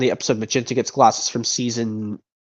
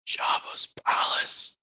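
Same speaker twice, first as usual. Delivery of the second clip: slow breathy whisper, masked bounty hunter; hushed whisper behind a helmet; disguised bounty hunter whisper; 10 dB quieter, much slower than usual. Acted. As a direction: whisper it low and secret like a hidden rescuer. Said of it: "another impression from this movie".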